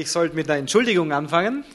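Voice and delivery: normal talking voice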